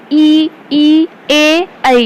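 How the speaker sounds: vowels sounded out one by one; front vowels demo. Shown as a demonstration. Teacher sounding out vowels for pupils, slow and clear. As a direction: model each front vowel clearly for the class. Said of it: "ଜିଭର ଅଗ୍ରଭାଗରେ ଉଚ୍ଚାରିତ ହବ".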